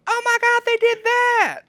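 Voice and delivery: put-on high, loud squeal of excited kids; thrilled kids. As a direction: shriek it like kids who can't believe it. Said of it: "And they go".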